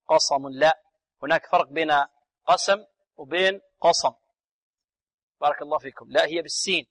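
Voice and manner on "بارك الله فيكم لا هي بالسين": normal talking voice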